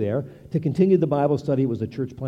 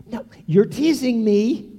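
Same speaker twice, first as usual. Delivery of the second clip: put-on slow, higher, louder girl's protest; granddaughter protesting; 7 semitones higher, 5 dB louder, slower than usual. Granddaughter protesting to grandpa that he is teasing, playful. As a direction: whine it playfully like a little girl.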